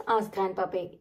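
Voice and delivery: ordinary delivery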